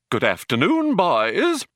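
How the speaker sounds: high, singing matron's greeting; matriarchal twang. A narrator's reading. Ship's computer greets the crew, matriarchal twang. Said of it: "It had a matriarchal twang".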